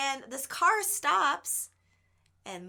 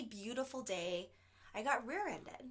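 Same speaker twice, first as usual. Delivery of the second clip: usual speaking voice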